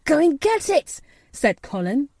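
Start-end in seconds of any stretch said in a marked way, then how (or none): 0.0-1.0 put-on high, loud boy's order; excited young master calling out. A narrator's reading. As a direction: urge him eagerly, at full pitch. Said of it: "digging excitedly".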